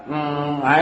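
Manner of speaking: normal talking voice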